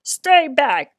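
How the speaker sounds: loud warning shout. A narrator's reading. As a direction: shout at him to stay back. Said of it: "shouted Bruce".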